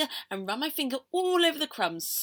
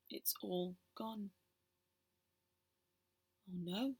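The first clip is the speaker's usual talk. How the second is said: dismayed hush; 3 semitones lower, 11 dB quieter, slower than usual. Acted. Realizing the cake is gone.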